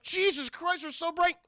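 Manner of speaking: high-pitched exasperated driver; startled complaint. Acted. Charlie hit by someone's high beams, startled. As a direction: complain as if suddenly dazzled.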